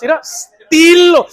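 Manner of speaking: high, slow, amused "mira, estilo"; mock admiring quip. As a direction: tease a passer-by's style. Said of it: "Y digo". (burla admirativa, alta y lenta)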